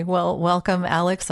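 usual speaking voice

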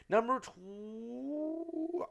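high, hugely stretched last word; "two" stretched out as if stalling; drawn-out counting. Pretend. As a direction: hold "two" very long while rising in pitch. Stretches number, abandons the count.

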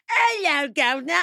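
high, hammed-up British "Hello, governor" voice; wacky British accent. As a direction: send up a cheesy British improv accent, pitched high. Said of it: "a wacky accent".

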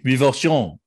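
put-on "Revulsion" with a French accent; mock French, stress on the last syllable; French pronunciation. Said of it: "in French".

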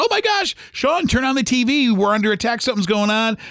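high-pitched retold panic, not shouted; freaking out. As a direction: imitate someone frantically breaking alarming news. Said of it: "she was freaking out".

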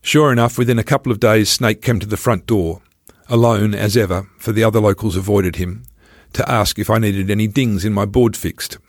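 usual speaking voice

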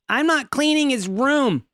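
high-pitched mock indignation; indignant refusal. Roommate acted out refusing to clean his room, indignant.

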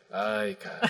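disappointed mom. Retold or imitated. Mom seeing her son's tattoos, soft disappointed sigh. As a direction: let it drop, disappointed. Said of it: "fue como de".

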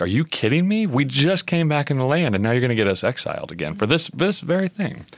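the prophet losing it at the people; exasperated Nehemiah. Retold or imitated. Nehemiah rebuking the exploiters, incredulous and high. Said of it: "he's going crazy".

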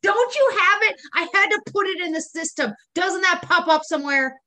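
high, indignant questioning; exasperated customer. Acted. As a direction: challenge the phone agent in exasperation. Acting out complaint to company representative.

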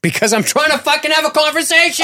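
yelling to get the chat back on track; exasperated yell. Real, not acted. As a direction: snap back in frustration. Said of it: "you keep yelling at me".

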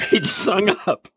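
real disbelieving laugh through the words. Real, not acted. Telling how the author hung up, laughing in disbelief.

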